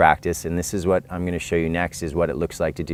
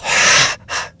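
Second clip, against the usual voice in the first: loud breathy exhale through the mouth; forceful exhale; 4 dB louder than usual. Shown as a demonstration. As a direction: push all the air out hard. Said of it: "exhale through the mouth".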